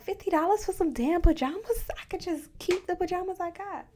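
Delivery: high, loud disbelief; incredulous. Retold or imitated. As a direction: balk at the price out loud. Balking at pajama price.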